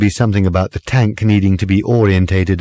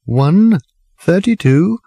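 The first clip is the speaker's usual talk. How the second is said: high sing-song clock, a little muffled; the talking clock heard through a towel; muffled speaking clock; 9 semitones higher, slower than usual. Acted. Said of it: "it sounded a bit muffled".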